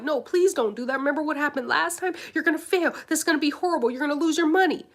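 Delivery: high, loud, urgent pleading; alarmed protective voice. Acted. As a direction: warn frantically, pitched high and loud. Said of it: "that voice is just trying to protect you".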